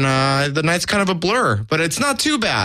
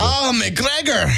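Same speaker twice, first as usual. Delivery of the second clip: put-on Irish accent; 8 semitones higher, much slower than usual. Mock Irish toast.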